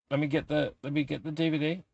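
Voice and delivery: soft muttered aside while fetching the DVD; mumbled aside. Real, not acted. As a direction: murmur it while reaching for the disc.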